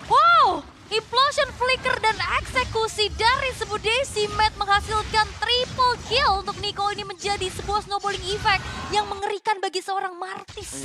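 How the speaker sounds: high, loud shouted hype call; fighting game announcer. Real, not acted. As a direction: yell the triple kill. Casting the triple kill. (komentator heboh berteriak)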